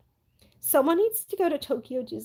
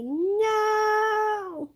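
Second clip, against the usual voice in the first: dismayed no; 8 semitones higher than usual. Real, not acted. New merch sold out.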